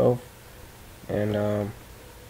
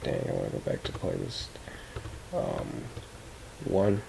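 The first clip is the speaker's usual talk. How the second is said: much lower, quiet grumbling; low mumble; 10 dB quieter than usual. Retold or imitated. Acted quote of frustrated user.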